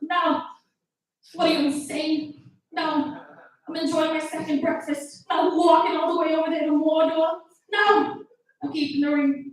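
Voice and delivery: high, indignant comic rant; Bill Burr. Retold or imitated. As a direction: imitate an exasperated comedian refusing outright. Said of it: "And Bill Burr's like".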